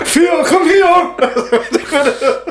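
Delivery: high, long drawn-out Kerry call; Kerry accent. Retold or imitated. Dog walker calling a runaway dog, Kerry accent. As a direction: holler for the dog in broad Kerry. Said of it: "with a Kerry accent came out".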